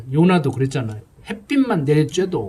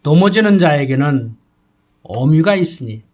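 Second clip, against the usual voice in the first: scripture reading; 4 dB louder than usual. Acted.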